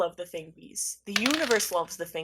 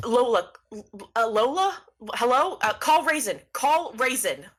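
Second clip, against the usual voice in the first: panicking; 3 semitones higher, 3 dB louder than usual. Acted. Cosmo frantically calls for Lola on the broken phone, panicking. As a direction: call into a dead phone with rising panic. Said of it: "they start panicking. They go".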